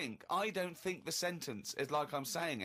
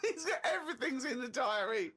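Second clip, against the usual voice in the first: higher voice cracking with laughter; laughing; 5 semitones higher than usual. Real, not acted. Co-host amazed at the trivial diary detail, laughing. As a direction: marvel through giggles.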